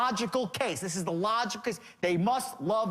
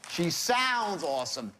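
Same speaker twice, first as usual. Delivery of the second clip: "sounds" slowed and leaned on, "She sounds awesome"; slow down and hit "sounds" in "She sounds awesome"; stress on SOUNDS; slower than usual. Shown as a demonstration. Correcting the audience on the wording, slow with weight on "sounds". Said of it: "There's a difference".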